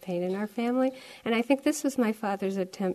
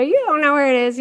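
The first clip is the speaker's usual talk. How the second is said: imitating a raging dad, much higher and louder; furious father; 7 semitones higher, 11 dB louder, faster than usual. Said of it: "He's so mad".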